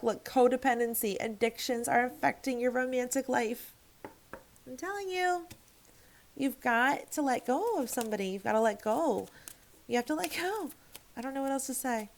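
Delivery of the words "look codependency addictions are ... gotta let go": usual speaking voice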